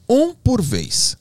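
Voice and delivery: very slow, high-pitched repeated order; retold order, drawn right out; insisting. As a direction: stretch each word out to cut through the chatter. Repeats the order after interruption.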